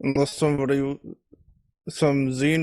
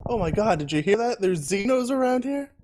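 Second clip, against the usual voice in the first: fake alarm; 6 semitones higher than usual. Character fakes alarm.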